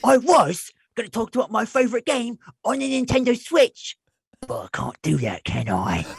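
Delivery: put-on British boy presenter, rising high then sinking; put-on British accent, let down at the end; Nigel. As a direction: present it as an earnest British schoolboy. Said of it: "his whole thing was like".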